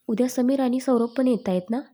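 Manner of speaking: usual speaking voice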